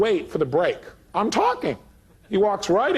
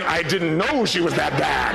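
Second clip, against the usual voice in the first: slow, laughing mock-panicked I didn't know; laughing inner panic; slower than usual. Speaker voicing his own panicked thought to the audience, laughing slowly. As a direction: stretch it out slowly with a laugh, half shouted. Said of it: "in my head, I'm going".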